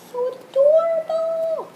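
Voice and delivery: very high, drawn-out squeal of delight; gushing squeal. Real, not acted. Vlogger melting over her adorable squishy, gushing squeal. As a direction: squeal it slowly, held high on the last word.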